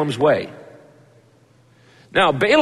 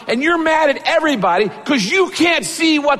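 rising preaching shout; 9 semitones higher than usual. Real, not acted. Preacher builds to a climax.

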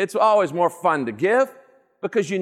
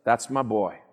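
proud father; 8 semitones lower, 4 dB quieter than usual. Acted. God voiced as a proud father.

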